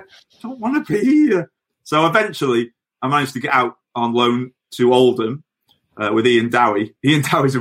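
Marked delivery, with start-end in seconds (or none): 0.0-1.5 high, childish mock plea; mock whine. Player begs manager to leave, high mock whine. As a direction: whine it like a sulky kid. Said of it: "I'm like".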